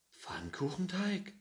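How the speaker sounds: 1 baffled question. Acted. Gustafsson baffled by the egg mush.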